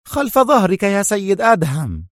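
high-pitched, worked-up order; agitated. A narrator's reading. Sonia about to cuff Adham, agitated. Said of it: "صاحت به في انفعال".

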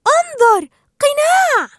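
high, loud cry of discovery; shouting. A narrator's reading. Dory spots the mask, excited high shout. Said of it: "صاحت دوري".

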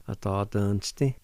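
"A, ta, dun, sti" blended into one word; joined-up pronunciation. Shown as a demonstration. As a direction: run it together, lifting on "sti". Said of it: "like this".

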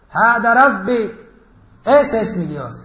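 loud, drawn-out declaration; proclaiming prophet. Acted. Ibrahim hails the star as his Lord, proclaiming loudly. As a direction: proclaim it loudly, stretching the words. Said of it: "y dijo".

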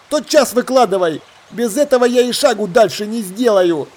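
pirate confronting a shipmate; suspicious pirate demanding answers. Acted.

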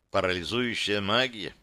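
usual speaking voice

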